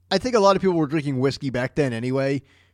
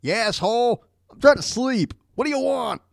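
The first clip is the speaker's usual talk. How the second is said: grumpy woken guy; 8 semitones higher than usual. Retold or imitated. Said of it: "I wonder if he said".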